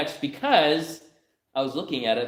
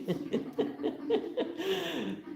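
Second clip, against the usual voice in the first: a high, soft giggle; high laugh; 8 dB quieter than usual. Real, not acted. Preacher laughing at the daughter's plan, a high soft giggle. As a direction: laugh at the absurd idea.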